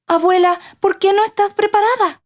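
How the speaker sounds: put-on high-pitched, surprised little girl; surprised child. A narrator's reading. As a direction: ask grandma in startled disbelief, pitched right up. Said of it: "Sorprendida al verla, Liu le preguntó". (niña sorprendida, voz muy aguda)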